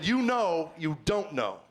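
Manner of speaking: drawn out, word by word; patient repeat with long, stretched words; slow repeat. Retold or imitated. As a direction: spell out YOU KNOW YOU DON'T KNOW slowly. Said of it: "Run that by me again".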